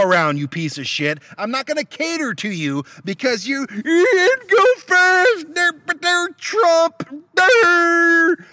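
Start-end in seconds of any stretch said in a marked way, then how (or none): 3.1-8.5 high, slow, breaking rage shout; furious yelling. Real, not acted. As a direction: scream it in real fury. Road rage outburst.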